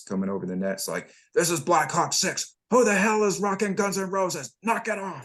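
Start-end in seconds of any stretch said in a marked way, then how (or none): 1.4-5.2 high, shouted angry radio order; irate commander. Retold or imitated. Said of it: "the irate commander's voice".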